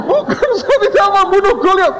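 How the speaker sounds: high, loud, fast pleading; panicked denial. Retold or imitated. Terrified student pleading he killed nobody, high and frantic. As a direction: plead your innocence in a panic.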